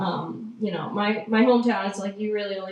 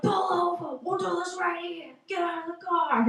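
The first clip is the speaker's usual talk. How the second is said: imitated road rage; 8 semitones higher than usual. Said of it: "he starts yelling at this truck driver".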